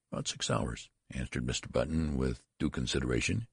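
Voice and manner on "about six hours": sheepish. A narrator's reading. Embarrassed father admits child's age.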